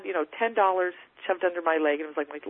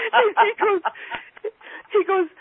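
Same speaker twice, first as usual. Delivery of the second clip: laughing; 3 dB louder than usual. Real, not acted. Laughs recalling the offer.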